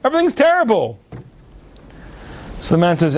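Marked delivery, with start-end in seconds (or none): 0.0-1.0 high-pitched mock outburst of complaint; exasperated protest. Moshe answers relative about his long face, exasperated. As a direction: protest indignantly, pitch held high throughout.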